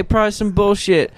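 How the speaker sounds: ordinary talk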